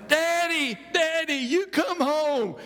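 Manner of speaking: high, childish mock cry; shouting Daddy. Preacher greeting his friend at the airport, high childish cry. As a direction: playfully shout like a lost little son. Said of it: "I started shouting".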